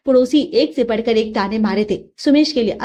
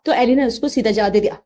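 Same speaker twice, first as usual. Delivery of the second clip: ordinary delivery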